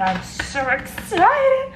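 drawn-out, high-pitched excited cry; put-on excitement, slow and high; excited squeal. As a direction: stretch it out in a high, giddy voice. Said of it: "The way the person goes".

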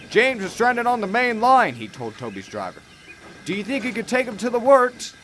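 high, brisk urgent manager. Acted. Yard manager asking Toby's driver for help, high and brisk. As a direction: hurry the request along.

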